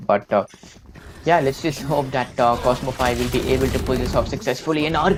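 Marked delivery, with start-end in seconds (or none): 1.3-5.1 fast, climbing play-by-play call; esports caster. Acted. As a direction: hype it up as the play unfolds. Rising live play-by-play.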